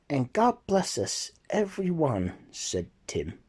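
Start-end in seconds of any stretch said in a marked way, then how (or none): 0.1-2.3 a lightly voiced small boy, high and earnest; Tiny Tim. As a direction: bless the whole family like a frail child. Tiny Tim blesses his family.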